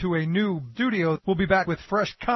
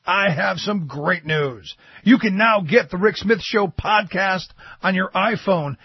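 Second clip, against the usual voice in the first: upbeat ad read, louder; promo read; 6 dB louder than usual. Acted. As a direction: sell the podcast like an announcer.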